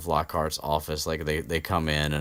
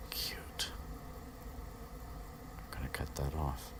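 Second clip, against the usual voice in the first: whispered aside; 3 semitones lower, 17 dB quieter than usual. Real, not acted. Aside about the air conditioner.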